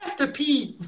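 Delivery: very high silly squeak; squeaky joke voice. Acted. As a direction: blurt it in a tiny voice. Player blurts high joke voice.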